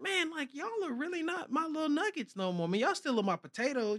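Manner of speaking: talking tenderly to his little ones; tender to the babies. Real, not acted.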